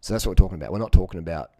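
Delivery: ordinary delivery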